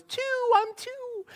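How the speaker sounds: put-on very high, slow toddler voice. Dad imitating his toddler son in a very high voice. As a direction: squeak it out like a proud two-year-old. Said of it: "and he goes".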